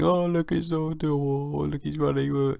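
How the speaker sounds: high cooing voice over a cute dog; cooing dog owner. Retold or imitated. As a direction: coo at the dog like a doting owner. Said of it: "she was going".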